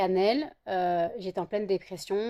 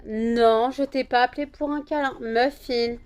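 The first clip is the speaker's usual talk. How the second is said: sharp raised telling-off to the dog; loud scolding; 4 semitones higher, 7 dB louder than usual. Real, not acted. Owner scolding her dog for jumping on her, loud.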